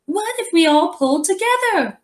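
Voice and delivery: high, light lady's voice for a leprechaun; accidentally ladylike leprechaun. A narrator's reading. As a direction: give the leprechaun a sweet lady voice. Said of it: "I gave him the lady's voice".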